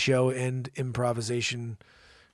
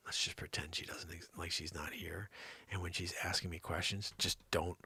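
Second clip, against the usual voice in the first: low, hushed scheming; conspiratorial; 8 semitones lower, 11 dB quieter than usual. Pretend. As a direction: let the audience in on a secret, very softly. Plotting a prank while she's away.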